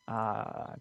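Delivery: normal talking voice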